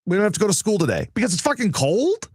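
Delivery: put-on high, sing-song gloating kid; mocking kid voice. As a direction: mimic a smug kid thrilled about no school. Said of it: "and it's like".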